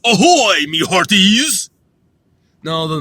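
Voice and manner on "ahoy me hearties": loud, slowed mock "Ahoy, me hearties!"; hammed-up pirate call; pirate hail. Judging his own pirate greeting, loud mock hail. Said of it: "it doesn't sound pirate-ish".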